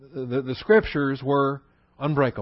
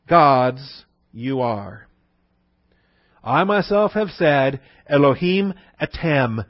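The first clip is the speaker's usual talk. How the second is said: slow, louder, weighty reading of the verse; solemn recitation; 4 dB louder, slower than usual. Shown as a demonstration. Teacher reciting Psalm 82:6 in Hebrew and English, slow and solemn. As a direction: declaim the scripture with weight. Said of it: "solemn recitation".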